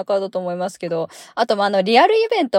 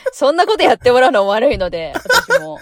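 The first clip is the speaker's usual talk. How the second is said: talking through a laugh; 5 semitones higher, 6 dB louder than usual. Real, not acted. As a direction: laugh while you say it. Laughing while declining the offer.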